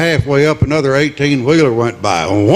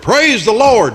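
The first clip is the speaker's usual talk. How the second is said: hollering; 8 semitones higher than usual. Retold or imitated. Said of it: "and he hollered".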